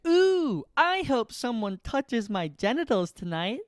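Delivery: high breathy ooh, lustful voice; horny voice. Retold or imitated. Adult alone at night, high lustful moaning voice. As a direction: moan it out like a lonely horny adult. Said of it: "late at night, we go home and we're like".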